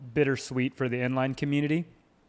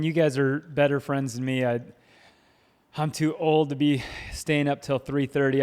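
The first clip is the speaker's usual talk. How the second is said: ordinary talk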